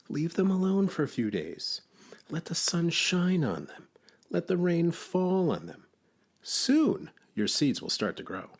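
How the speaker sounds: high, patient storybook character; Frog. A narrator's reading. Frog advises Toad about seeds, high patient voice. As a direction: advise calmly in a high creature voice.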